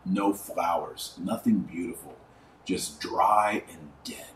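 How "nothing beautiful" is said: normal talking voice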